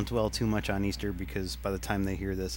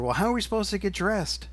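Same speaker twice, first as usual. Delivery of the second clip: higher-pitched indignant call upward; retorting up to the front desk, exasperated; mock yell back; 9 semitones higher than usual. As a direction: construction workers calling down, mock indignant. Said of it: "they're screaming back to her".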